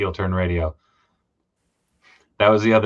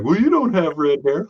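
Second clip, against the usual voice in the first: put-on higher taunting kid, ha ha; mocking kid voice; 6 semitones higher than usual. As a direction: tease like a schoolyard kid. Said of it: "I remember growing up being like".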